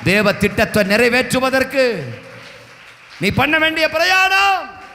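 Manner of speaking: loud, slow building sermon cry; rising revival shout; preaching climax. Acted. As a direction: build the crowd up, stretching each word.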